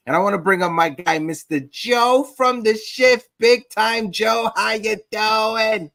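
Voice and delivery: big hype-man welcome, stretching the name; guest introduction. Real, not acted. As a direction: bring on the guest like a ring announcer. Host announces the guest onto show.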